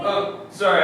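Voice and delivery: ordinary talk, nothing marked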